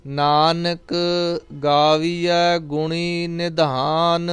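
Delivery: slow, drawn-out devotional chanting of each line; scripture recitation. Acted. Teacher reciting Gurbani for students, slow devotional chant. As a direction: intone the verse slowly and reverently.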